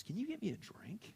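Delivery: soft whispered ask for a drink; whispered request. Acted. Jesus quietly asking the Samaritan woman at the well, whispered. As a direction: breathe the question gently, no voice.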